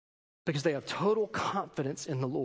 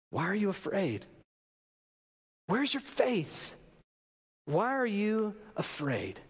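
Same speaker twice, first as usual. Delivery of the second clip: gentle question; 2 semitones higher, slower than usual. Retold or imitated. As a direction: ask it gently, letting each word sit. Jesus questions disciples.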